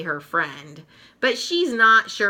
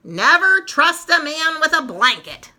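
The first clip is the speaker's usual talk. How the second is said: louder, higher grumbling jab; mock the blanket with disdain; a touch of bitter sarcasm; sour remark; 8 semitones higher, 6 dB louder than usual. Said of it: "Sally says".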